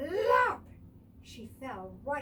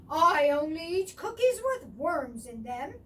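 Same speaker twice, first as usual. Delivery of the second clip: louder grumpy badger voice; 4 semitones higher, 9 dB louder than usual. A narrator's reading. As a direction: grumble it like a grouchy badger. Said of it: "he said very grumpily".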